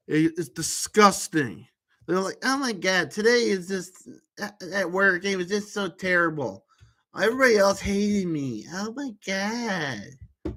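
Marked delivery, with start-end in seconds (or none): 2.4-10.5 mock nagging woman. Wife complaining about her work day, mock nagging. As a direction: imitate a nag, stretching the final words. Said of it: "you hear a nag voice all the time".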